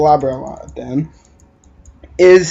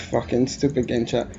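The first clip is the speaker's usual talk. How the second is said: muttered under the breath, much softer, fed up; irritated aside at the game chat; annoyed mutter; 4 semitones lower, 9 dB quieter than usual. Real, not acted. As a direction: grumble it to yourself in disgust.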